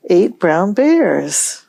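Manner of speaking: ordinary talk, nothing marked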